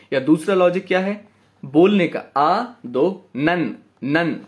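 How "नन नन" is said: नन drawn out slowly, two ना merged; slow blending. Shown as a demonstration. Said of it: "दोनों शब्द को एक साथ मिला रहा हूं". (नन को धीरे मिलाकर)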